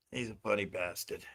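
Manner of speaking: ordinary talk, nothing marked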